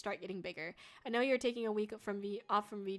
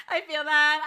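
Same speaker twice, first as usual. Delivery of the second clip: laughing; 5 semitones higher, 10 dB louder, slower than usual. Real, not acted. Laughing at a chat comment.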